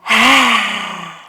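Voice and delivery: loud open-mouth sigh, tongue out; lion's breath exhale. Shown as a demonstration. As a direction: sigh it out hard through the mouth. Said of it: "Exhale through the mouth with your tongue sticking out".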